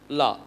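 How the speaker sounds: லா with a very brief 'a'; very short vowel. Shown as a demonstration. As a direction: barely hold the vowel in லா. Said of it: "ரொம்ப ஷார்ட்டா".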